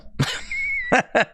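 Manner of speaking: very high laugh; squeaky emotional laugh; teary high laugh. Real, not acted. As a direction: laugh through being moved. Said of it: "I'm gonna start crying now".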